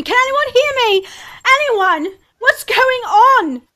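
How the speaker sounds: loud, high frantic call; screaming. Acted. Captain shouting to the crew for anyone alive, frantic scream. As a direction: scream out across the deck. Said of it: "I just start screaming".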